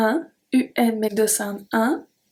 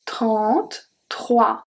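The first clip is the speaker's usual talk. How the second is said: Trente-trois very slowly, nasal en clear; slow model word; much slower than usual. Shown as a demonstration. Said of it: "E N make the sound en".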